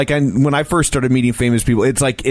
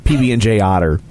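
normal talking voice

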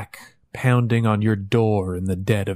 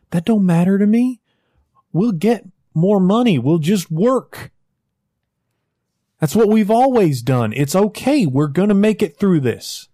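much higher and louder, pleading; desperate shouted reassurance; 9 semitones higher, 5 dB louder than usual. Acted. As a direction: shout the comfort at him. West desperately reassures Jack.

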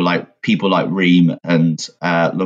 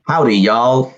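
higher, louder hammed-up Southern drawl greeting; mock cowboy greeting; 6 semitones higher, 3 dB louder, slower than usual. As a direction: tip a hat, play the cowboy.

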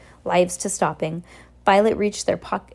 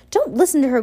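high, urgent plea; pleading; 7 semitones higher, 4 dB louder, faster than usual. A narrator's reading. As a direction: plead with her, voice raised in pitch. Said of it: "Quigley pleaded".

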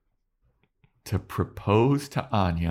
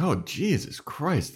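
higher, slow startled gasp; mock shock; 8 semitones higher, slower than usual. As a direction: let the surprise drag out. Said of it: "He's like".

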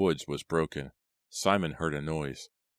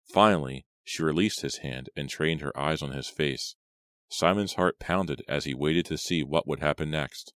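usual speaking voice

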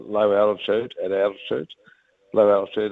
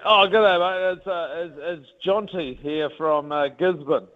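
higher, chatty, a bit hesitant caller on the phone; imitating a friendly Kiwi fan; 7 semitones higher than usual. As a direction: play the eager local fan ringing to wish luck. Retold phone call from fan.

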